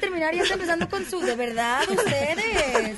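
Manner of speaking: high, loud playful scolding; teasing telling-off; mock indignation. Real, not acted. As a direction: protest at being cut off, voice jumping up. Playfully scolds hosts for interrupting her.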